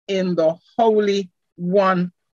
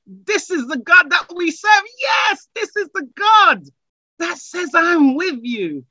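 full-force preaching; 5 semitones higher than usual. Acted. Pastor lifting the congregation, full-force rousing preaching. Preaching climax before prayer.

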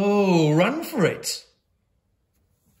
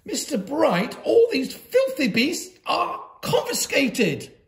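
high, louder imperious order; stern headmistress; 10 semitones higher, 4 dB louder than usual. A narrator's reading. Headmistress ordering teacher to confiscate pets, imperious. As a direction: hand down the ruling with disgust.

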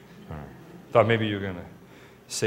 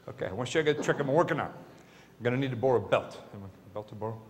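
ordinary talk, nothing marked

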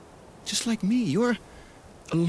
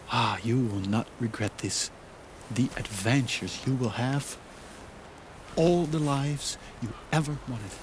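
normal talking voice

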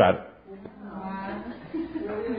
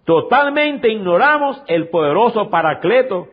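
fervent preaching; 5 semitones higher than usual. Acted. Pastor repeating the key verse to the brethren, fervent. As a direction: drive the point home a second time.

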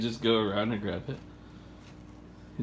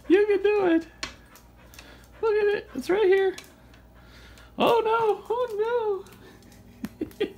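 very high, playful cheering; encouraging dog talk. Real, not acted. Owner urging dog to reach toy, high encouraging. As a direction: egg a pet on in a high voice.

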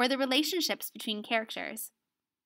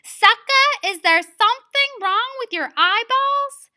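loud, high-pitched challenge; said louder; 11 semitones higher, 9 dB louder than usual. Acted. Student [name] acting out her line louder on stage, loud challenge. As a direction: belt it out, high and confrontational. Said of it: "and said louder".

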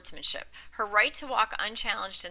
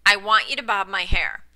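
firm demand; 7 dB louder than usual. A narrator's reading.

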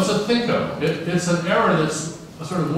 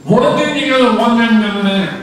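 high, loud, laughing garble of made-up sounds; undigitizable sounds; 8 semitones higher, 8 dB louder than usual. Shown as a demonstration. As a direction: blurt out sounds no one could copy. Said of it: "Here comes case two again".

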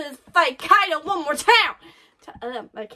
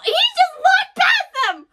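slow, very high and loud disbelief; stunned excited shout; 6 dB louder, slower than usual. Real, not acted. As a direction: stretch it out, shocked and thrilled. Reacting to Luffy ignoring enemies.

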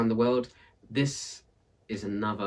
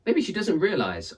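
higher, wondering baker woman; Maria's voice; 10 semitones higher, 5 dB louder than usual. Acted. Maria suggesting to the villagers, higher wondering voice. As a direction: offer it gently, higher up.

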